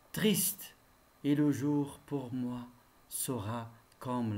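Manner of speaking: measured classroom recitation of a poem. Acted. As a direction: recite solemnly, lifting the openings of the verses.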